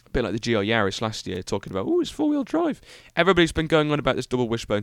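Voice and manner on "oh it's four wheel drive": very high put-on squeaky voice; high-pitched mocking quote; mock hype. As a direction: parrot the fuss in a silly falsetto. Mocking others' hype about cars.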